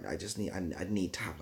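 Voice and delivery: ordinary delivery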